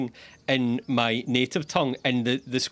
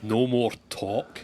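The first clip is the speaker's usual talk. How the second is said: lower, slow Scottish bear mimicry; imitated Scottish bear; 5 semitones lower, slower than usual. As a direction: copy the Scottish bear slowly, pitched down. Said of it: "He's a big Scottish bear".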